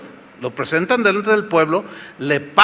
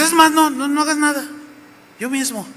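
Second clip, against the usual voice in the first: scheming wife; 9 semitones higher than usual. Acted. Wife takes over the plot, high then hushed. Jezabel taking charge.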